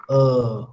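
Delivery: low, drawn-out "uh"; long vowel. Shown as a demonstration. Teacher showing how the vowel is pronounced, long low "uh". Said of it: "how it is pronounced".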